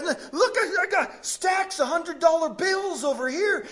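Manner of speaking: high, loud, laughing with glee; excited laughing call. Retold or imitated. Second leper showing off his cash, laughing loud and high. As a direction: brag about the cash through laughter. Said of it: "the other guy's".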